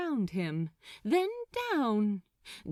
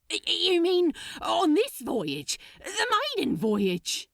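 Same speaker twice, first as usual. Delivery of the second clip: high, loud, tripping over the first word; stammering shock; 10 semitones higher, 5 dB louder than usual. Acted. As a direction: panic rising as it sinks in. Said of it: "unable to contain himself".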